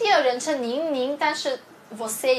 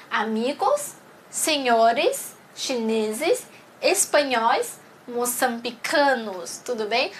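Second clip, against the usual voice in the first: slow model reading; slower than usual. Shown as a demonstration. Teacher reads answer words aloud.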